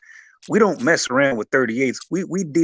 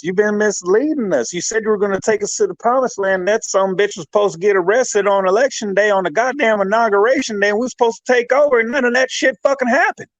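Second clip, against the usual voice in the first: whiny quoted followers, higher, rushing faster toward the end; mocking his members; 7 semitones higher than usual. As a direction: build up the members' complaint, speeding up. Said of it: "They're saying".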